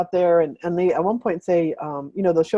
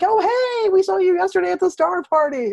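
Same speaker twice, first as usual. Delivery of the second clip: excited recognition; 12 semitones higher, 3 dB louder than usual. Retold or imitated. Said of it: "some people are like".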